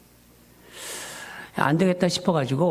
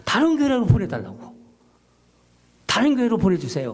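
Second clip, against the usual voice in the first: higher, pleading complaint to God; reenacted complaining prayer, raised pitch; arguing with God; 4 semitones higher than usual. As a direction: protest to God like a frustrated son. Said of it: "힘이 드니까 막 그 제가 나오는 거죠".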